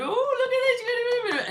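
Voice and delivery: high, drawn-out silly coo; high mock squeal. Retelling her friend's teasing over his shirt, high mock squeal.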